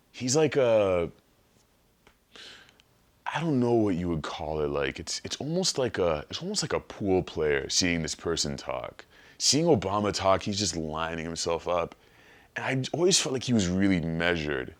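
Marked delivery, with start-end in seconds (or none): none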